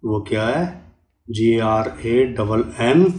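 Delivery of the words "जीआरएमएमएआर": letters spelled out very slowly, one by one; slow spelling. Shown as a demonstration. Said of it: "गलत कभी मत करना".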